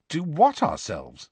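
slow, wary question; suspicious. A narrator's reading. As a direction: drag it out with suspicion. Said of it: "said Harry suspiciously".

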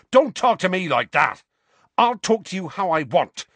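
high, loud angry exchange; heated quarrel. A narrator's reading. Harry and Seamus trading angry lines in the dorm. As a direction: fire it back without backing down.

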